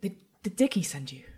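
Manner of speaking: whispered, stammering question; hushed girl. Acted. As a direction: ask in a wary whisper. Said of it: "sees you and is quiet".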